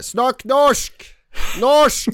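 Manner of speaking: high, loud heckler's yell; mock shouting. Imagined theatregoer heckling a Sami-language play, shouted high. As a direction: holler it as a pretend audience demand. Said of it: "Satt du og ropte sånn der".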